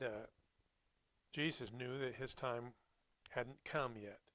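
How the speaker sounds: usual speaking voice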